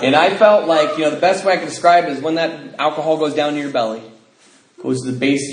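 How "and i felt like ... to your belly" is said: ordinary talk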